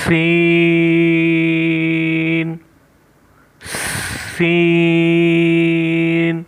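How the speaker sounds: سین held very long with a whistling hiss. Shown as a demonstration. Teacher showing pupils the whistling letter, slow. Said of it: "سین کے لیے ہم سیٹی کی آواز نکالیں گے".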